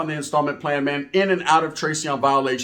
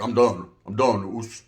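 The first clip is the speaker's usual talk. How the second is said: tired big cellmate; 8 semitones lower, slower than usual. Retold or imitated. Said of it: "He was like".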